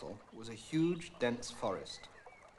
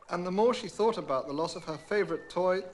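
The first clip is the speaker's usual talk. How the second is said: ordinary delivery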